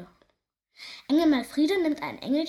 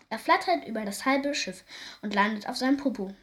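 plain reading voice

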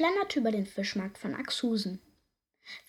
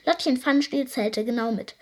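plain reading voice

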